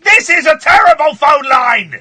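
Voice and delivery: loud, high exasperated shout. Real, not acted. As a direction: yell it in mock outrage. Complaining about bad phone line.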